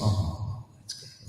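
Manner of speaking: ordinary talk, nothing marked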